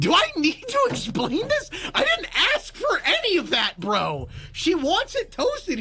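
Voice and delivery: high-pitched, incredulous complaint; exasperated protest. Retold or imitated. Customer objecting to random toppings, exasperated. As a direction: protest like you can't believe the order.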